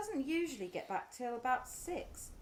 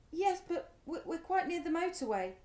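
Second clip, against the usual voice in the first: ordinary talk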